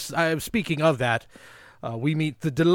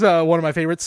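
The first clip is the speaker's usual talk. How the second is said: normal talking voice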